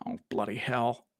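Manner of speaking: low, soft frustrated mutter under the breath; muttered to himself. Real, not acted. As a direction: swear quietly to yourself while searching. Searching for a lost post.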